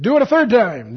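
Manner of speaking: loud, high order; commanding. Acted. Elijah ordering water a third time, loud command. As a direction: call out the order firmly. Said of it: "He said".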